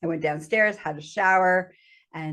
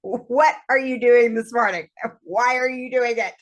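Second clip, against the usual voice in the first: higher, louder mock scolding; acted exasperated questioning; retold confrontation; 5 semitones higher, 4 dB louder than usual. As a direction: demand answers, pitched up and insistent. Partner confronts her in retold scene.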